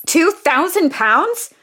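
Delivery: can't believe the price, drawn out; shocked disbelief. Acted. Shocked at the excess fee.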